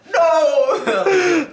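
high, loud drawn-out "no"; hammed-up wail of dismay; mock cry of dismay. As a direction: play up the horror, loud and high. Acting out reaction to cracked phone.

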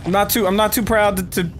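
normal talking voice

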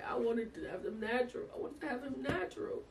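high, half-laughing wail; mock-wail to God about missing out; rueful lament. To God, a half-laughing lament over the C-section.

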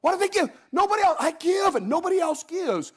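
high, whining church member; whiny complainer. Retold or imitated. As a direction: whine that nobody else gives. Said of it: "somebody else says".

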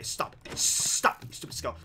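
unvoiced hiss of annoyance; hissed plea. Real, not acted. Gamer hissing at the skeleton to stop. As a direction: whisper it sharply at the attacker.

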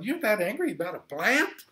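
high, mocking disbelief; exasperated parent rebuke; God incredulous. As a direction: tell him to get real. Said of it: "And God's going".

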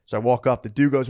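ordinary delivery

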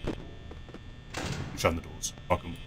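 tense whisper. Real, not acted. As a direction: whisper it under your breath. Tense whisper shutting doors.

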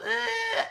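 high mock crying noise; short squealed imitation of a fussing kid; imitated child whine. Mom showing daughter the noise to stop, whining. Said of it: "She stopped crying".

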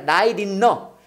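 "light in 너" with the T tapped like R. Shown as a demonstration. Teacher demonstrates after naming the R, flapped pronunciation. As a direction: run "light in 너" together as "라이린너". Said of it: "이게 R이야".

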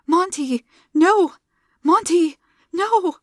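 high, loud anguished wail; anguished cry. A narrator's reading. Zilla crying out over dead Monty, anguished. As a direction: cry out over his body. Sees her fiancé dead.

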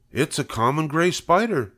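a husband waving off his wife; dismissive farmer. A narrator's reading. Husband voiced.

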